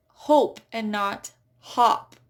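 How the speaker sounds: "hope" with a long O against "hop" with a short O, slow; contrasting "hope" and "hop"; long vowel contrast. Shown as a demonstration. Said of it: "that E at the end".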